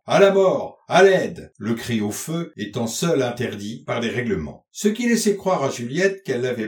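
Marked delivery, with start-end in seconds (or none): 0.1-1.5 loud, higher-pitched theatrical cry; hoarse-voiced cry. A narrator's reading. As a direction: call out loudly as if for help, putting on a stage cry. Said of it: "d'une belle voix rauque criait". (cri théâtral, fort et lancé)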